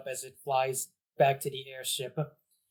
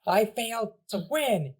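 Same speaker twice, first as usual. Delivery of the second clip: high, puffed-up bratty voice; a defeated brat saving face; indignant kid villain; 8 semitones higher, 4 dB louder than usual. Acted. Bowser Junior insisting.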